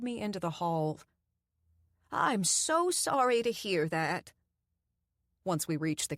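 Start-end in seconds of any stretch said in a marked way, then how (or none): 2.1-4.3 slow, lightly voiced Southern grandma; a hint of a kindly old woman; sweet old lady. A narrator's reading. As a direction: coo it like a doting grandmother. Doreen sympathizes.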